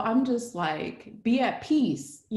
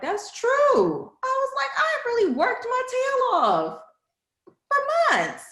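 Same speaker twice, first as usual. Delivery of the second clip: much higher, louder indignant exclaim; 5 dB louder, slower than usual. Retold or imitated. Telling her advisor about months of work, high indignant cry. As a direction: protest that she worked hard for months.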